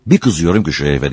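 usual speaking voice

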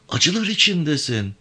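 high-pitched, worried woman's voice; concerned woman. Acted. Avke seeing Yavan in pain, concerned woman.

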